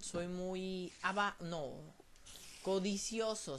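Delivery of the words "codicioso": ordinary delivery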